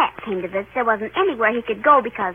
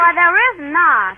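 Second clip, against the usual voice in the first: put-on louder, higher child's denial; indignant protest; 4 semitones higher, 6 dB louder than usual. Sister contradicting her brother's accusation, indignant protest. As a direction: deny it loudly, pitch up.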